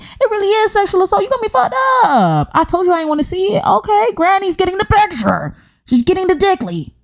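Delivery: high-pitched mock indignation; way up high, hammed-up scolding; mock outrage. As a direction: squeal it in shrill comic outrage. Mock shouting at sender.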